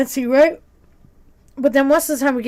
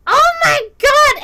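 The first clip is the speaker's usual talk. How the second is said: very high, loud, stretched squeal; tipsy old lady; 8 dB louder, slower than usual. Retold or imitated. Regular's wife gushes at the server, high tipsy squeal.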